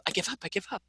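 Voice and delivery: small, soft voice giving up; softly played defeat, half laughing; mock surrender. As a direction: imitate someone quietly caving in. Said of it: "a little fight with Amanda where she goes".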